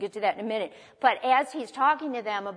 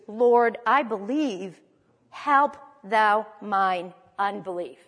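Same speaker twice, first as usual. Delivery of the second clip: normal talking voice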